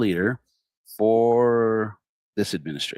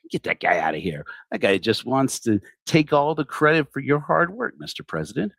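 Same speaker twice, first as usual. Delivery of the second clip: ordinary talk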